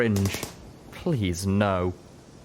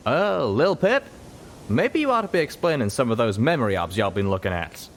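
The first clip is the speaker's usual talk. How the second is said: higher folksy Southern voice; 4 semitones higher than usual. A narrator's reading. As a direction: tease her like a country colt. Calamity voiced, drawl.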